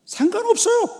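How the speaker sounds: very high, loud outburst; emphatic shout. Real, not acted. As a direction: shout it out to the congregation. Emphatic declaration, then repeated normally. (높고 크게 외치기)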